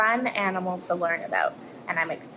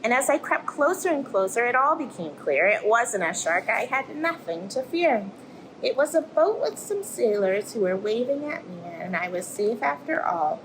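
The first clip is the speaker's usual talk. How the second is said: lively storybook voice for little ones; reading to children. Acted. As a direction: tell it like a bedtime tale, rising and falling. Reads picture book to children.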